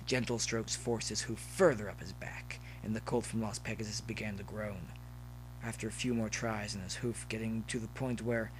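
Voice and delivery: ordinary delivery, nothing marked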